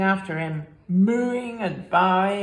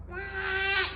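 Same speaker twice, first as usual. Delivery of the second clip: high quack; a tired duck's quack; 14 semitones higher, 3 dB quieter than usual. Acted. Duck answers.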